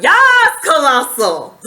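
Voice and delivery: high, loud cheer of agreement; screamed out. Retold or imitated. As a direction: shout yes with delight. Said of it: "I actually screamed out".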